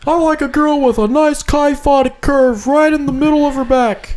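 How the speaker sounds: high, strained mock Jersey rant; hammed-up Jersey accent. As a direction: rant like an indignant Jersey tough guy. Continues the Jersey tough-guy bit.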